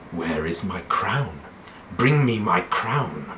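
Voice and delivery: slow, understated mad prince; quietly unhinged royal demand; Viserys Targaryen. Acted. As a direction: demand it slowly, with menacing pauses. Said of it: "he's also totally batshit crazy".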